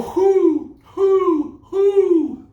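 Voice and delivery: loud, high hooting; owl hoots. Shown as a demonstration. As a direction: hoot three times like an owl, high and loud. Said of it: "a bunch of owls".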